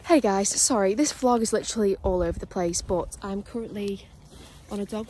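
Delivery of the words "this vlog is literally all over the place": ordinary talk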